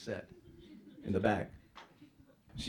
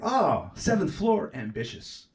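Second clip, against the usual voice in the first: mock impressed; 6 semitones higher, 7 dB louder, much slower than usual. Seeing the huge spider.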